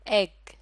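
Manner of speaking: एग said clearly, starting on a short eh; demo word egg. Shown as a demonstration. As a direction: model एग for the learner. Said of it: "लफ्ज़ एग के शुरू में".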